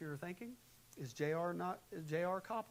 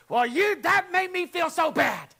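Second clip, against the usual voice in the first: very loud, high, slow accusing yell; accusing outburst; 20 dB louder than usual. Shown as a demonstration. Spouse yelling blame in an argument despite promising not to. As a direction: blow up at them while claiming you aren't. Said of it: "even if you say".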